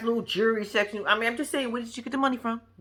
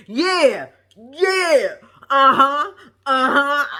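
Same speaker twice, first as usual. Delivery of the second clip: loud, high, emphatic agreement; imitated hype-man grandma chiming in; 4 semitones higher, 7 dB louder, much slower than usual. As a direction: cheer from the background as grandma. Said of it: "she the hype man".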